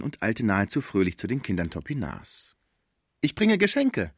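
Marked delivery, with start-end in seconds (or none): 3.2-4.1 high, lifted, smiling voice; said with a smile. A narrator's reading. Old musician brings gifts to children, high smiling voice. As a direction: announce it happily, pitched well up. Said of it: "sagte er mit einem Lächeln".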